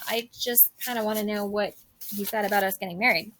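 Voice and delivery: ordinary talk, nothing marked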